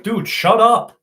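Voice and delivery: high, loud retort; exasperated scolding. Retold or imitated. Son cutting off dad's matchmaking, loudly exasperated. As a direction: snap loudly at dad to stop. Retold scolding of embarrassing dad.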